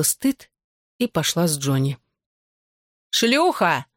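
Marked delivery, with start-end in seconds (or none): 3.1-3.9 higher-pitched, contained shout; shouted insult. A narrator's reading. Bella yells after the girl leaving, a contained shout. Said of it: "крикнула вслед Белла, достаточно громко".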